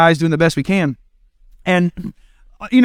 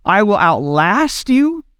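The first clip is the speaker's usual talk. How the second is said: higher, slow, drawn-out parent insisting; mock-stern parent, pitched up and slowed; exasperated parent; 5 semitones higher, slower than usual. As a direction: outlast the kid, word by word. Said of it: "And you look at".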